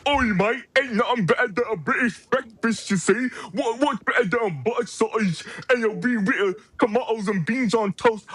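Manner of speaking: put-on a jeering Cockney-style British accent; Gabe Kidd British accent. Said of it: "being like".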